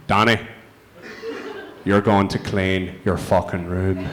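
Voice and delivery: slow, low, icy threat; mock cold Northern Irish mum, slow and low. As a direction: go cold like a mother who never yells. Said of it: "her voice would just go cold".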